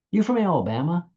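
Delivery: mock-innocent guess, pitched high on a rising tune; teasing joke question. Speaker teasing a stranger with a foreign accent, high playful rise. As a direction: tease a foreigner about their accent, voice lifted. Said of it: "I get people laugh".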